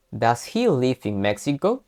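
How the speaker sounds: slow, with "Mexico?" lifted upward; rising question tune. Shown as a demonstration. Teacher demonstrates upward question intonation. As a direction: end "Does he live in Mexico?" going up. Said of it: "terminar con una entonación hacia arriba".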